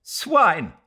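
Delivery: loud high scornful cry; contemptuous spit. Acted. Mrs Turton echoing Leslie, loud contempt. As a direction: spit the word out.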